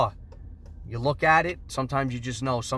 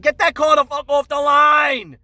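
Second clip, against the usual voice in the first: slow, much louder and higher barked order; angry shout; 11 semitones higher, 11 dB louder than usual. Retold or imitated. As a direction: roar the command across the yard. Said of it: "I said".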